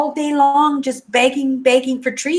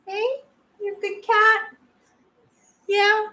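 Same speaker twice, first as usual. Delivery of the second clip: much higher, softer pet-talk coo; 10 semitones higher, 3 dB quieter than usual. Real, not acted. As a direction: sweet-talk the cat up close. Praising her cat.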